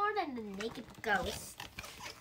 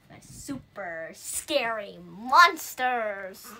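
slow, drawn out and louder; mock spooky emphasis; 3 semitones lower, 12 dB louder, much slower than usual. Ghost teasing about the monsters, slow mock emphasis. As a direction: stretch the words for mock fright.

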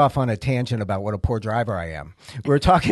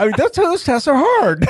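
high-pitched, laughing protest; 12 semitones higher, 4 dB louder than usual. Real, not acted. Host excuses his failed test to the guest, laughing. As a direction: defend yourself through real laughter.

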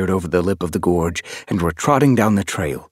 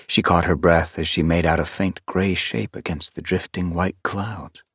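plain reading voice

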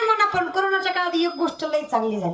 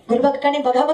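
ordinary talk

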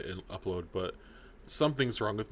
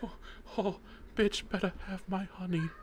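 much higher cartoon bear voice; 10 semitones higher, 3 dB quieter than usual. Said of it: "Jim Cummings ... the wife beater".

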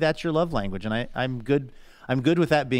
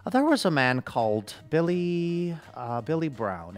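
put-on Irish accent; 5 semitones higher than usual. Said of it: "and he would say".

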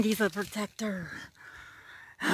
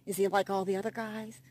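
ordinary delivery

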